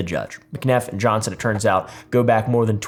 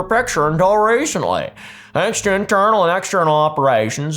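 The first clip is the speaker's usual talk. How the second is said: put-on Utah accent. Host reading an executive's statement on the record, in a put-on Utah accent. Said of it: "That's my best Utah accent".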